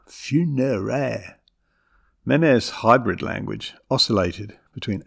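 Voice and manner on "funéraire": drawn-out French "funéraire"; French pronunciation. Retold or imitated. Granddaughter imitating grandmother's word, French pronunciation. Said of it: "but in her own manner".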